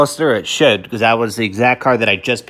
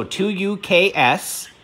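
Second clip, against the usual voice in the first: dictating; 6 semitones higher, much slower than usual. Shown as a demonstration. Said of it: "read it back to me once again".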